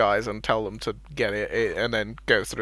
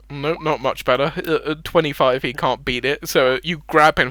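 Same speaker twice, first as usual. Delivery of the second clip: ordinary talk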